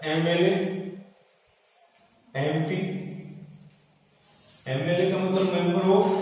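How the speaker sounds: normal talking voice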